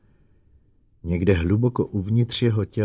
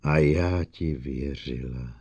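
low, slow, wounded reproach; hurt, betrayed; 5 semitones lower, slower than usual. Acted. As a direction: say it slowly, sunk in disappointment. Betrayed, to the robot.

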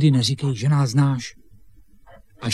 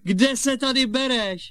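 high, drawn-out exclamation; feigned surprise; 11 semitones higher, 3 dB louder than usual. Acted. Boy greets supposed brother, feigning surprise. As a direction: overplay astonishment at seeing him.

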